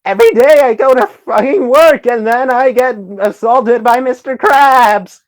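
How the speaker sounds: very high, loud cartoon sponge voice complaining; shrill-free squeaky cartoon rant, loud and high; SpongeBob voice. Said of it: "I'll try doing a SpongeBob voice".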